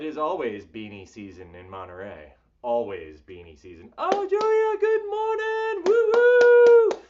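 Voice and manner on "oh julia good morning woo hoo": high, loud whooping greeting; excited cheer. Real, not acted. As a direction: whoop it out gleefully.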